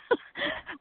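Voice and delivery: a high burst of flustered laughter, half-breaking; nervous laugh. Real, not acted. Interviewer on a failing hotel connection, nervous laugh. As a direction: laugh at the mess despite yourself, close to tears. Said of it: "please don't make me laugh".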